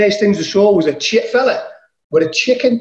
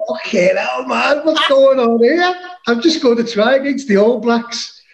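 higher, slow, amazed exclamation; reliving the shock of the crowd cheering; retold disbelief; 6 semitones higher than usual. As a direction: sound stunned by what you just did. Stunned after scoring a try.

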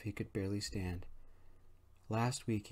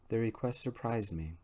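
plain reading voice

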